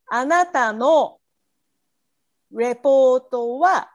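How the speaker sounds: normal talking voice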